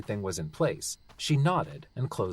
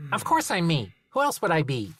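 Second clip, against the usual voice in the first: gnome voice; 6 semitones higher, 4 dB louder than usual. Acted. Gnome character voice.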